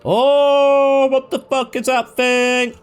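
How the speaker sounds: streamer startled by the thing in the game, high loud shout; high, drawn-out cry of alarm. Real, not acted. Player reacting to a strange sight, startled shout.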